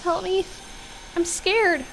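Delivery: put-on high, scared little-boy voice; scared child's scream. A narrator's reading. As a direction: cry for help as a frightened child. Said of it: "Ryan screams".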